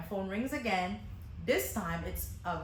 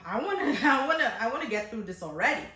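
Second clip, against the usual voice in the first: laughing, louder "I wanna" while exasperated; laughing exasperation; 4 semitones higher, 7 dB louder, faster than usual. Retold or imitated. Stylist laughing about wanting the ordeal over, exasperated laugh. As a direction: laugh through the impatience. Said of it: "I also was like".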